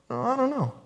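high, soft mock "who, me?" voice; feigned ignorance. Preacher mimicking people who claim they didn't know, feigned ignorance.

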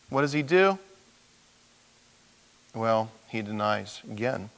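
ordinary delivery

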